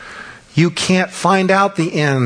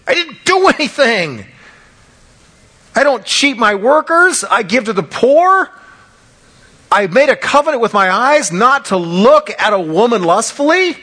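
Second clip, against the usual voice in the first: Job protesting innocence; 9 semitones higher than usual. Retold or imitated. Preacher as Job insisting to his friends, high and desperate. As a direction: cry out your innocence against the accusers. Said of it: "He's like".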